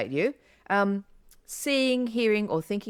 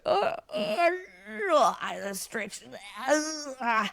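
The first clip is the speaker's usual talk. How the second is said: mock yawning groans with a stretch, pitched high; imitated yawn; 7 semitones higher, slower than usual. Explaining yawning to her co-host, mock yawning. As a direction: act out a big sleepy yawn. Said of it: "You go".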